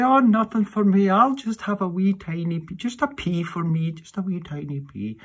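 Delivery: very high, small, dainty voice, loud at first and fading away; dainty wee woman. Retold or imitated. Wee woman politely refusing food at Christmas dinner, in a very high, dainty voice. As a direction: put on a prim little voice for a lady refusing food. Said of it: "and she would say".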